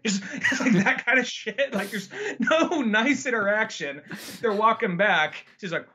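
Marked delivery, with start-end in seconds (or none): none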